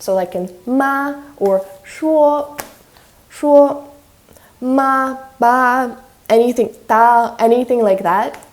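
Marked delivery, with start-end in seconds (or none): 0.6-7.4 ma, shuo, ba, ta held high on one level; steady high tone. Shown as a demonstration. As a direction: hold ma straight across at a high, steady pitch. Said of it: "Steady high pitch and holding just one level".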